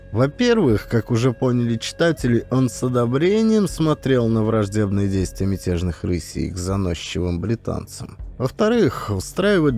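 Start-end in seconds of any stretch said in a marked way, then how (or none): none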